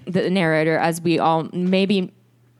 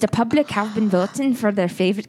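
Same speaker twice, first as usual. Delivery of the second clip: Irish accent imitating a show host's announcement; Maura Higgins. Shown as a demonstration. Quoting the host's vote announcement to her cohost, Irish accent. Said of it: "it was very Maura Higgins".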